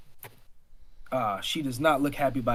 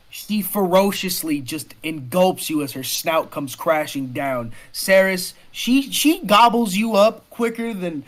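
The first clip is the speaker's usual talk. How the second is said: raised, forceful game master voice; excited monster-attack narration; dramatic action narration; 6 semitones higher, 7 dB louder than usual. Acted. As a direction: build the attack up loud and high.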